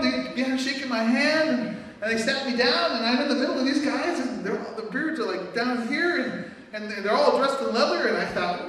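ordinary talk, nothing marked

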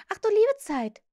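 put-on high, loud girlish exclamation; exclaiming. A narrator's reading. Wendy realises Peter misunderstood her, exclaiming. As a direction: burst out in high-pitched dismay.

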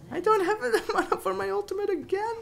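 high-pitched fast whine; high squeal. Real, not acted. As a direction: squeak it out in dismay. Out of mana, dismay.

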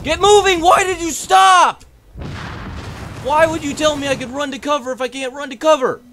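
screaming high and loud at the game; rage-yell at a stuck character; furious shout. Real, not acted. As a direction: gamer meltdown yell. Character won't move, raging.

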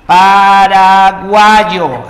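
"paraguayo" stretched slowly and loudly; syllable by syllable. Shown as a demonstration. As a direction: sound out "paraguayo" in slow, loud, separated chunks. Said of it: "tú sabes, separando sería paraguayo".

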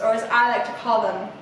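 plain reading voice

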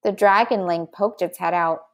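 usual speaking voice

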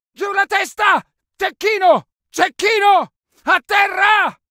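urgent warning shout. Acted. Soldier warning squad of a sniper, urgent shout.

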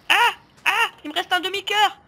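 high panicked yelp; panicked cry. Real, not acted. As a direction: cry out in alarm near death. Nearly dead in game. (cri paniqué, aigu)

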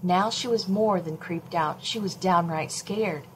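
ordinary delivery, nothing marked

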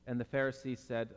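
ordinary delivery

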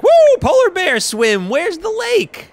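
high, hammed-up eager cheer; excited whoop. Player voicing Rabbid Luigi eager for a swim, excited whoop.

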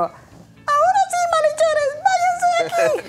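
very high squeaky superhero helium voice. Pretend. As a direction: heroic lines on a lungful of helium. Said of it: "cuando el Superman hablara".

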